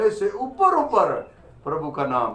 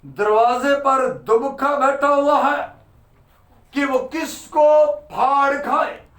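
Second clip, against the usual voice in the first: raised, high-pitched preacher's warning; preaching warning; 7 semitones higher, 4 dB louder than usual. Real, not acted. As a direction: build the danger in a high, urgent pulpit voice. Warns congregation of lurking devil. (ऊँची आवाज़ में उपदेशक की चेतावनी)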